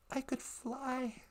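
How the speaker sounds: very soft and slow; hushed film quote. Retold or imitated. Quoting Thomas's story from the movie, hushed. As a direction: let it drop to a slow hush.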